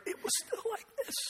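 very high, faint, rushed squeak; lost voice. Shown as a demonstration. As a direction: demonstrate a voice that has given out. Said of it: "when I lost my voice".

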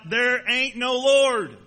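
slow, cranky neighbour shouting back; angry shout. Acted. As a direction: yell it back over the fence. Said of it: "he would shout".

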